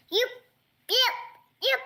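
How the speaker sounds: high yips like a little fox; yappy chihuahua voice. Pretend. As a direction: yap like a small fox. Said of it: "this is a very good fox impression".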